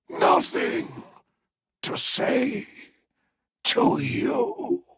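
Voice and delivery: slow, hoarse gasps with long pauses; weak, croaking last words; dying reproach. Acted. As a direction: force each phrase out on failing breath, voice mostly gone. Dying man reproaches his betrayer.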